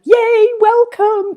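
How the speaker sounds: high, slow, delighted "yay"; squealing with delight at new arrivals; excited cheer. Real, not acted. Cheering viewers arriving live.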